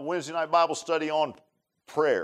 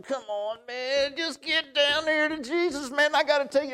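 straining urging; 11 semitones higher than usual. Acted. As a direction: coax as if tugging someone by the arm. Imagined dragging someone to be saved.

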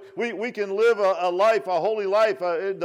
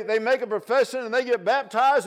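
ordinary delivery

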